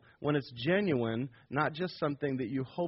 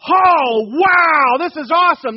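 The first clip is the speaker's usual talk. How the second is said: very loud, high, gushing fake delight; mock excitement; 10 semitones higher, 16 dB louder, slower than usual. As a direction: ham up the coworkers' cheering. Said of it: "They're not gonna go".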